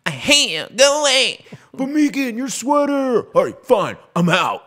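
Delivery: put-on high, loud squealing girlfriend quarrel; imitating a comedy sketch breakup, high and loud; Key and Peele Megan bit. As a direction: ham up a high-pitched breakup tantrum. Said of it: "the Megan skit from Key and Peele".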